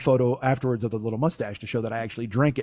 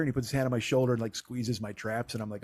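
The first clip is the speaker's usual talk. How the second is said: ordinary talk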